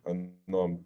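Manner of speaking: ordinary talk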